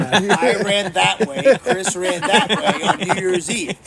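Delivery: amused, loud and laughing. Real, not acted. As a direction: laugh it out slowly. Laughing confession of streaking.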